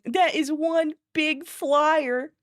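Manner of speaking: slow, high cartoon dinosaur voice; drawn-out, wide-eyed kid dinosaur; slow high awestruck cartoon voice; Triceratops quote. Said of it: "If I ever said that in public".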